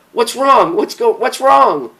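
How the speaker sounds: high, loud worried questions; urgent, frightened asking; alarmed friend rushing over to ask; anxious. Acted. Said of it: "Ramona asked anxiously".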